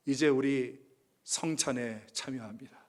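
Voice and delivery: ordinary talk, nothing marked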